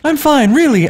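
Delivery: high, squeezed falsetto; choked girl voice. Acted. Anna squeezed in her sister's hug, high voice. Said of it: "Anna choked".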